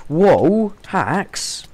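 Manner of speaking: very loud, very high shout of shock. Real, not acted. Gamer seeing a cheating player, shocked loud shout. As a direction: explode in disbelief. Sees impossible move.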